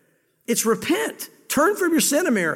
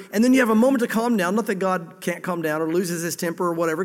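usual speaking voice

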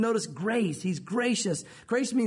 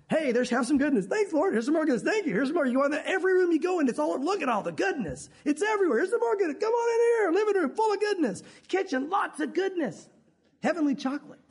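high, delighted tour of goodness; giddy host showing every room; excited host; 7 semitones higher than usual. Retold or imitated. God's house full of goodness.

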